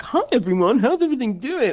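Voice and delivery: high, bright hello; high cheery greeting. Retold or imitated. Imitating own greeting in streams.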